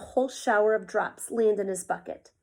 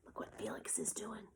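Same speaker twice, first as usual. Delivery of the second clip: whispered tattle; 18 dB quieter than usual. Acted. Anna tattling on Felix.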